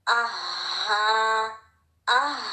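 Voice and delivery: आहा drawn out with a strong airy ह; strong ha drill. Shown as a demonstration. Teacher drills the breathy ह, drawn out. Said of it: "has to be strong".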